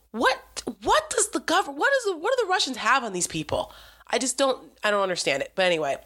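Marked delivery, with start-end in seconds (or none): 0.1-3.7 high, incredulous what-on-earth; sighing, pitched-up disbelief; exasperated disbelief. Real, not acted. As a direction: ask it in baffled exasperation.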